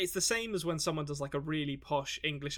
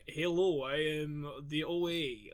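put-on slow, hammy sixties-spy Russian accent; bad Russian accent; slower than usual. Mocking an actress practising her accent, hammy Russian accent. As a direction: drag it out in a mock Russian accent. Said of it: "she's kind of like".

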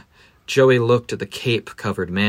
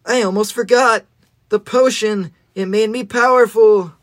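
put-on higher, excited young hero; sudden realization, pitched up; high boy voice; 9 semitones higher, 3 dB louder than usual. Joey's voiced line in story.

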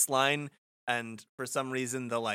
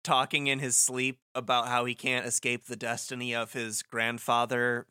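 ordinary delivery

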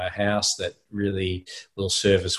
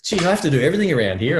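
fast, much higher mock complaint; hammed-up wife grumbling she does everything; mock exasperation; 9 semitones higher, 4 dB louder, much faster than usual. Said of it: "almost a domestic argument".

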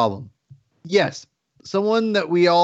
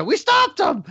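much higher, cartoonish victory shout; mock triumphant cheer; slower than usual. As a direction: celebrate like a kids' show hero, way up high. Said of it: "and then go".

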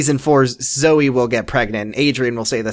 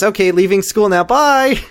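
mock chirpy; 7 semitones higher than usual. Mock Jack's chirpy reply.

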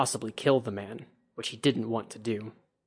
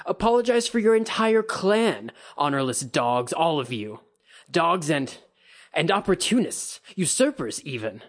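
loud, much higher ranting insults; drunken insult; 6 semitones higher, 4 dB louder than usual. A narrator's reading. Kikita insulting the Bayushi clan, loud drunken rant.

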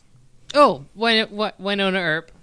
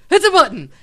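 high loud exclamation; excited exclamation; 12 semitones higher, 13 dB louder, faster than usual. Pretend. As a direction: announce it loudly and high. Excited reveal after boo.

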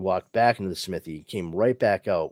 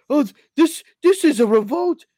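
panicky guard voice; 9 dB louder than usual. Retold or imitated.

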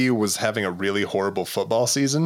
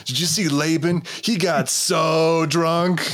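mock stiff movie dialogue; 6 semitones higher, much slower than usual. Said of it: "because, like".

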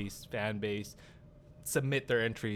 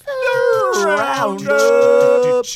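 announcer voice; 13 semitones higher, 13 dB louder, much slower than usual. Acted. Introducing the segment with fanfare.

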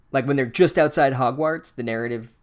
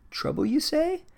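much higher, mock-curious question; intrigued Spider King.

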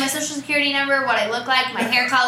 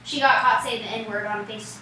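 ordinary talk, nothing marked

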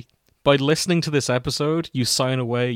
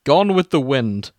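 mock-solemn fanfic reading, mostly at usual pitch; story narration; 6 dB louder than usual. Podcast host reading a crack fic to cohosts, mock-solemn narration. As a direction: read the opening like a grave eulogy, tongue in cheek.

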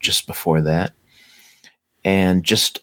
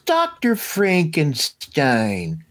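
high, drawn-out call of the name, Frankenstein ending in "stine"; student's question; 8 semitones higher, slower than usual. Retold or imitated. Student standing up to question the doctor, high stretched call. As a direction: call out "Frankenstein" high and slow, rhyming with "mine". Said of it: "a student who stands up and asks".

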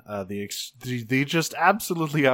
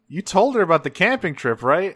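raised pitch, pointed questioning; anxious checking; 6 semitones higher, 4 dB louder than usual. Retold or imitated. Carlos asks Rad if he told her, anxious checking. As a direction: check urgently that it was done.

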